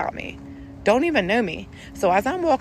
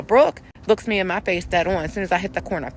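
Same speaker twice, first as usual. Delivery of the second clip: ordinary talk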